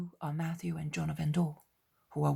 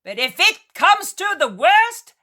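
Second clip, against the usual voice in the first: high, loud threat; threatening shout; 12 semitones higher, 14 dB louder than usual. Acted. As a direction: threaten over the wind. Peter threatens over storm.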